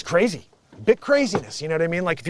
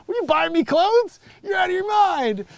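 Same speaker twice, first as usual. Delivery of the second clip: much higher, louder mock outrage; 13 semitones higher, 5 dB louder than usual. Rapper scoffing at being bought clothes, squeaky outrage. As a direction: scoff at the offer in a squeaky rage.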